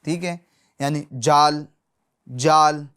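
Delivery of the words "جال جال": جال said short with a j sound; wrong zaal with j. Shown as a demonstration. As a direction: show the mistake, جال with a j. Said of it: "جیم کی آواز لے آئے". (ذال میں جیم کی غلط آواز)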